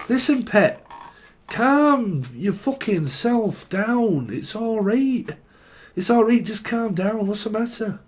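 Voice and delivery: high, slow Scouse calming voice; a Liverpool mum soothing someone in Scouse; Scouse accent. Pretend. Scouser telling a worried friend to calm down, high Scouse voice. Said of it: "This is a Scouser".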